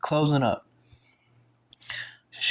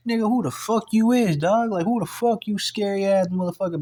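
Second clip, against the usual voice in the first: higher-pitched heated tirade, building at the end; retold angry rundown; 3 semitones higher than usual. Speaker confronting the man who pulled a pipe, angry rundown. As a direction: tear into him, increasingly worked up. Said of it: "'cause I was mad".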